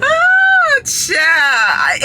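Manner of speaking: laughing cry. Real, not acted.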